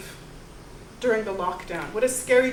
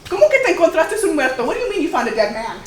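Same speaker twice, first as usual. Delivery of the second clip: shocked disbelief; 7 semitones higher, 9 dB louder, faster than usual. Acted. Maria reacts in disbelief.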